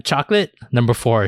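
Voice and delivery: ordinary talk, nothing marked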